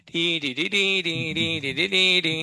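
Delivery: string of little "tee-dee" noises; playful nonsense vocalising. Pretend. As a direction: make a running tee-tee sound effect while working. Teacher vocalises while breaking complex apart. (खेल-खेल में T-T की आवाज़ निकालना)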